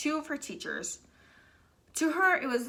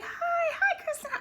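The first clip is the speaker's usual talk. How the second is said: much higher, sing-song mock greeting with a laugh; fake-sweet teacher. Science teacher greeting Kristen after being told off, mock sugary. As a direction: chirp it like a suddenly too-friendly teacher. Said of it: "her science teacher was like".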